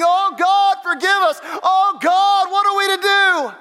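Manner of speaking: loud, high anguished plea; pleading cry to God. Pretend. Preacher voicing a grieving believer, anguished. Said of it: "grieving and saying".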